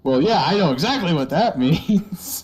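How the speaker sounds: sheepish laugh through words; laughing admission. Retold or imitated. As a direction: give in, laughing. Kids admitting they know.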